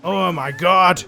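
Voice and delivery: loud, drawn-out exclamation of delight; thrilled outburst. Real, not acted. Player overwhelmed by the baby creature, loud thrilled outburst. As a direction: belt it out slowly, overwhelmed with delight.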